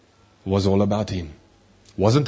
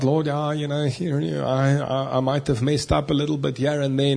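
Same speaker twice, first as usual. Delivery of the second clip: evasive mumbling excuse; 3 semitones higher than usual. Shown as a demonstration. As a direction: shrug it off half-heartedly.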